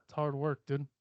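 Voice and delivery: ordinary talk, nothing marked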